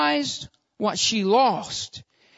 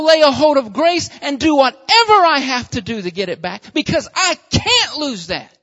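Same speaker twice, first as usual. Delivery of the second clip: louder, higher, impassioned preacher; full-force preaching; 5 semitones higher, 7 dB louder than usual. Acted. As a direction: build to a fervent climax.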